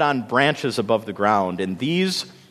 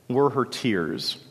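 usual speaking voice

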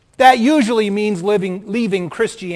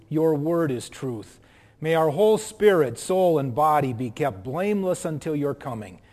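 soft, lower prayer voice; 3 semitones lower, 4 dB quieter than usual. Acted. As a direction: pray it quietly and reverently. Leads congregation in prayer.

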